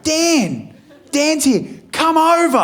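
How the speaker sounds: put-on high, excited kid's call; child calling out. Son spotting Dan over the road, calling high. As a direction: shout across the road like an eager young boy.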